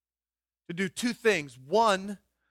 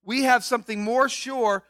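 emphatic build; 3 semitones higher, 6 dB louder than usual. Real, not acted.

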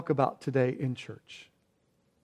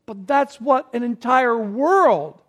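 fiery preaching; 7 semitones higher, 7 dB louder than usual. Acted. Preaching at full force.

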